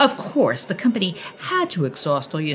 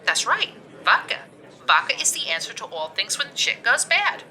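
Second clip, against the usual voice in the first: Karen's voice; 6 semitones higher than usual. Acted.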